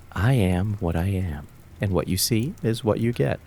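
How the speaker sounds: normal talking voice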